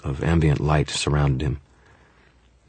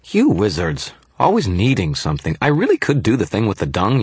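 put-on high, peeved little-kid fairy; indignant tiny fairy, pitched up; Toot's scowling grumble; 9 semitones higher than usual. A narrator's reading. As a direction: grumble a threat in a high childlike voice. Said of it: "Toot scowled up at me".

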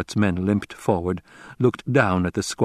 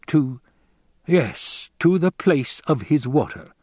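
hesitant confirmation; 7 semitones higher, slower than usual. A narrator's reading. Hawat answers with hesitation.

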